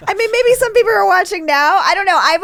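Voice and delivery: real giggling answer; laughing. Real, not acted. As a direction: laugh through the words. Laughs at own joke.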